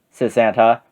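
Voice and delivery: ordinary delivery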